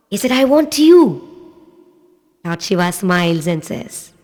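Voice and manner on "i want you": put-on eager child. Boy answers god eagerly.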